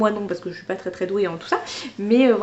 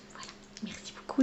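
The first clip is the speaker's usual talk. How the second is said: soft whispered thank-you; a whispered merci; 2 semitones lower, 16 dB quieter than usual. Real, not acted. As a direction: breathe your thanks, just voiceless. Whispered thanks to the gift-giver.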